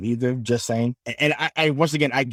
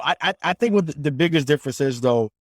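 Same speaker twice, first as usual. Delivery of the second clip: ordinary delivery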